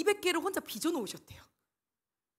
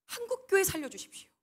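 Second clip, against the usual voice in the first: fervent prayer; 8 semitones higher, 5 dB louder than usual. Retold or imitated. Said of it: "이렇게 기도하셨다는 거예요".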